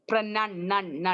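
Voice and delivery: "pronun-, nun, nun" with the NUN syllable repeated separately; syllable drill. Shown as a demonstration. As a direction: drill "nun" as a short u, not "noun". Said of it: "Say it".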